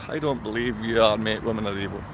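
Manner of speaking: low, quiet mocking murmur of the troll's words; mocking repeat.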